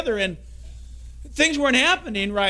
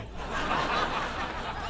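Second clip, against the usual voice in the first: breathy, airy laugh at his own joke; real laugh; 9 dB quieter than usual. Real, not acted. Preacher laughing at his own worry joke, real and breathy. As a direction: laugh at the punchline.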